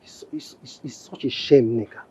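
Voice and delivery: low, soft, slow reproach; subdued husband, let down by his wife; pained disappointment. Acted. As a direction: let it sink heavily, quietly. Husband laments wife's behaviour.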